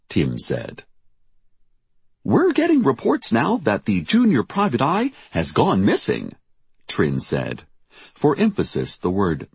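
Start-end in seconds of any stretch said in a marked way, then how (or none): 2.2-6.3 high-pitched, dramatic breaking-news read; urgent news anchor. A narrator's reading. Trin breaking news of a missing teen, urgent anchor. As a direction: announce it big, pitch way up.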